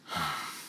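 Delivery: a soft, tired sigh downward; weary sigh. Acted. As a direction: sigh under the weight of fate. Heavy fate of old friend.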